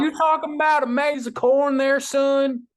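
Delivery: mock Southern voice teasing a friend about corn; country accent. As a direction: play a folksy Southern uncle. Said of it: "We ain't in Kansas".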